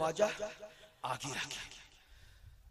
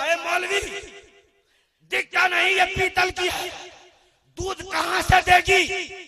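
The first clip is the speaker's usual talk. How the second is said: loud, high angry retort; angry pandit; 8 semitones higher, 7 dB louder than usual. Retold or imitated. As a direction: pandit shouting in rage at the cleric. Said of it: "Pandit جی کو غصہ آ گیا". (غصے سے چلاتے ہوئے)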